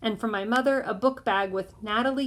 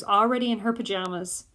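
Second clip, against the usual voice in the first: usual speaking voice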